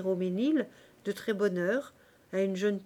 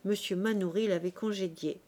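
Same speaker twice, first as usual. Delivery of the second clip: ordinary delivery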